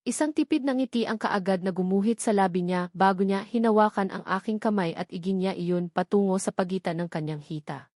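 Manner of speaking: usual speaking voice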